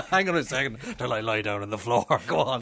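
laughing. Real, not acted. Host laughing at deep voice.

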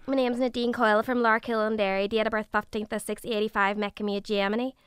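[name]'s Derry accent; Derry lilt, doing [name]; Northern Irish accent. Shown as a demonstration. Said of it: "can you do that again".

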